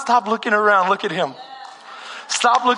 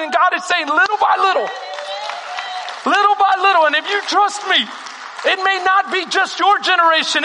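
full-force preaching; 6 semitones higher than usual. Acted. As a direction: preach it out to the whole congregation.

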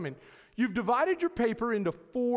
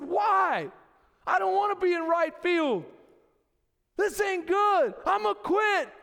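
higher, louder whiny protest; boy complaining to his coach dad, higher and louder; kid protesting; 7 semitones higher, 4 dB louder than usual. Retold or imitated. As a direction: complain like a nine-year-old who hates right field. Boy protesting right field.

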